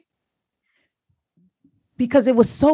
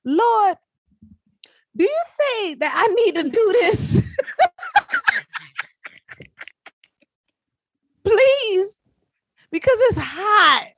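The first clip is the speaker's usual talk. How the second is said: pleading prayer; 11 semitones higher than usual. Retold or imitated. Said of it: "I'm like".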